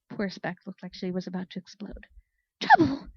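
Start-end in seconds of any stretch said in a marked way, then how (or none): 2.6-3.1 high, loud outraged cry; exploding outrage. Acted. Speck exploding at Flare's remark, loud outrage. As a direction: burst out in disbelief.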